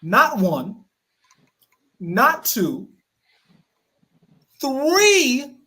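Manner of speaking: spaced-out count, rising on three; lightly imitated boastful countdown; LeBron James voice. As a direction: count it off with long pauses, lifting the last number. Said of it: "use my LeBron James voice".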